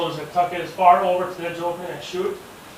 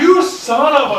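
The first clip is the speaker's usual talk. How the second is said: higher and much louder, cut off; shocked yelling curse; 11 semitones higher, 12 dB louder, slower than usual. Retold or imitated. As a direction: blurt the curse in loud disbelief. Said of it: "I'm like".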